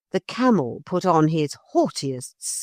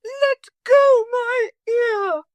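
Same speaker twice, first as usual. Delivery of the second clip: very high, slow pained scream; 4 dB louder, slower than usual. A narrator's reading. Motor lady screaming at the parrot to let go. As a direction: shriek for release. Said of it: "screamed the lady".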